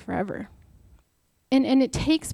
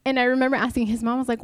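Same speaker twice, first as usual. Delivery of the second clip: ordinary talk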